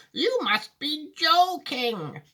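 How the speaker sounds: high, slow disbelief; disbelieving Grandpa Joe. Acted. Grandpa Joe doubting Wonka, slow and high. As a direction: draw it out in astonishment.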